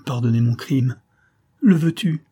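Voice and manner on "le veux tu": high, pleading question through tears; dying daughter begging her father's pardon; tearful plea. A narrator's reading. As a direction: beg forgiveness, voice full of tears. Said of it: "Sa voix et ses yeux étaient pleins de larmes".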